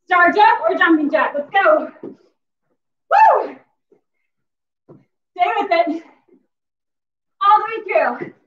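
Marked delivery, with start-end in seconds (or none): 2.0-3.6 high whooping cheer; excited fitness whoop; cheer woo. Real, not acted. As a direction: whoop to pump up the class. Coach cheering during workout.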